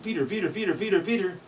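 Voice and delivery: high whistled "Peter, Peter" song; titmouse call. Shown as a demonstration. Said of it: "a bird saying".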